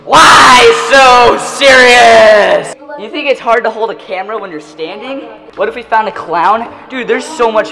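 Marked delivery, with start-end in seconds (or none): 0.0-2.7 loud, slow drawn-out villain line; Joker voice. Pretend. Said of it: "Joker quote, shouted".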